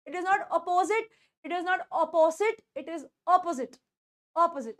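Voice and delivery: It is not opposite said very slowly and clearly; slow model sentence. Shown as a demonstration. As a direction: spell the sentences out for learners. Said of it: "demo sentence". (धीमे और साफ़ बोले वाक्य)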